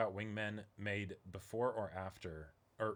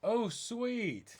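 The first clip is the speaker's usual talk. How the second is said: high bright delight; a pleased little squeal of thanks; 12 semitones higher, 5 dB louder than usual. Real, not acted. Co-host hears about the covers and squeals with delight.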